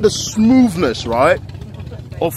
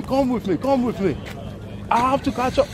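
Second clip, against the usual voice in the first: ordinary talk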